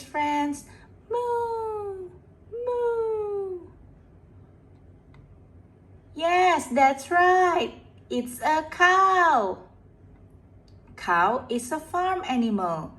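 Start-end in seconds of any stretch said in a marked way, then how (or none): 1.1-3.7 high drawn-out mooing; cow moo. Pretend. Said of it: "Can you guess what sound is this". 6.1-9.6 excited. Real, not acted. Cheering the right guess.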